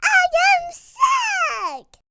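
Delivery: high, loud, slow joyful cry; delighted. Acted. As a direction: celebrate being sick. Delighted at diagnosis.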